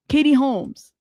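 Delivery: usual speaking voice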